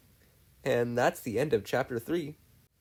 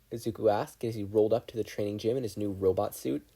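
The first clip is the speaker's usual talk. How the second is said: plain reading voice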